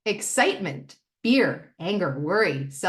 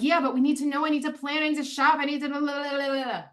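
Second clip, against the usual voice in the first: higher-pitched mock fretting; mock worried rambling, pitched up; anxious past self; 7 semitones higher, faster than usual. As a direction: imitate your old frantic self, babbling into blahs. Mimicking her past anxious self.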